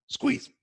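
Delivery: quick sharp gasp through the nose, then squeeze. Shown as a demonstration. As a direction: gulp in that last bit of air. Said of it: "let's try it".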